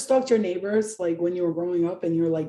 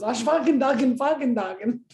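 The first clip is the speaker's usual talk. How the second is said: mock German gibberish; 4 semitones higher than usual. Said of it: "all I heard was".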